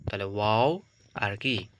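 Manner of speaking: usual speaking voice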